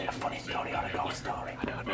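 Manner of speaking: hushed whisper so the storyteller isn't interrupted; breathy, unvoiced whisper. Real, not acted. Whispering during someone's story.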